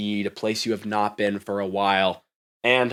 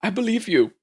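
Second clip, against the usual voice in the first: higher, knowing corporate voice; a sly wink at the robot; executive woman; 9 semitones higher than usual. Acted. Executive reassuring the robot with a knowing tone.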